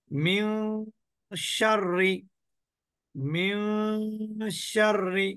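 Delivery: মিউ drawn out into a nasal hum before শাররি; ghunnah demo. Shown as a demonstration. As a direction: hold the nasal of মিউ, then release শাররি. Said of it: "অনিশ্বারের মতন".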